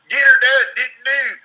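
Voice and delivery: high hammed-up Southern drawl; loud, high hillbilly caricature voice; bad Southern accent. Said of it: "real bad Southern accent".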